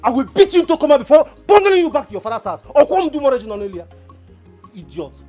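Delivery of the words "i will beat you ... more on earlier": loud, high furious threat; angry shout. Acted. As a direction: yell the warning at him in full fury. Threatening Uncle Ferogi over occultic man.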